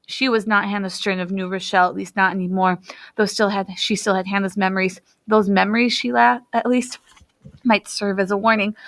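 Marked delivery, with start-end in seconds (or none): none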